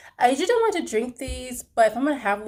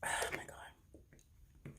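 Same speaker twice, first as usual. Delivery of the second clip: whispered; 19 dB quieter, much slower than usual. Real, not acted. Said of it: "awed reaction".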